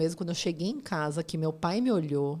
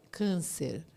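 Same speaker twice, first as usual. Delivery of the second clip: grave doctor; 4 semitones lower, 5 dB quieter than usual. Retold or imitated. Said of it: "E aí ele falou".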